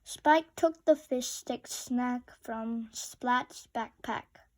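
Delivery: ordinary delivery, nothing marked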